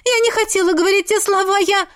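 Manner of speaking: high, rushed tearful voice; tearful plea. Acted. Sick daughter begs her mother's forgiveness, tearful. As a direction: pour out the apology near tears. Daughter pleads, sobbing apology.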